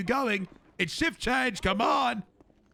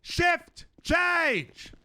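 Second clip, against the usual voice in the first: shouted; 8 semitones higher than usual. Acted. Repeated call, shouted take.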